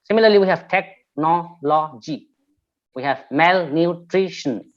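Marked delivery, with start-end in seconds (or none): 0.7-2.3 "technology" in four separate beats; syllable by syllable. Shown as a demonstration. Teacher showing a polysyllabic word, syllable by syllable. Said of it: "we have polysyllabic words like". 3.3-4.7 "malnutrition" slow, in separate beats; syllable by syllable. Shown as a demonstration. Teacher showing a polysyllabic word, syllable by syllable. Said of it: "need to identify these kinds of syllables".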